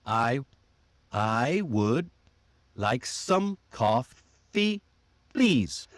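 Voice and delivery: very slow "I would like some coffee, please." with gaps between beats; clapped-out stress rhythm. Shown as a demonstration. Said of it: "you can try clapping".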